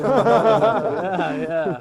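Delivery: loud laughing yeah. Real, not acted. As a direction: laugh it out loudly. Laughs at own story.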